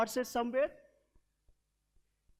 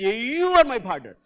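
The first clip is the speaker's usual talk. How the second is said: with energy; 7 semitones higher, 6 dB louder than usual. Shown as a demonstration. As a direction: belt it out to your partner. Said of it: "you got to say with energy".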